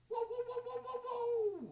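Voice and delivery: high, drawn-out laughing whoa; Jeff's whoa. Retold or imitated. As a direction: belt out a long excited whoa. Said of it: "I can't do it like Jeff does".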